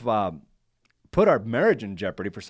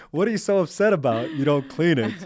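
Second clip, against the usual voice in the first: higher, louder hammed-up wife voice; mocking wife; 5 semitones higher, 5 dB louder than usual. Husband repeating his wife's words in a teasing put-on voice. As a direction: play her up for laughs, mock-scolding. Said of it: "you're telling me".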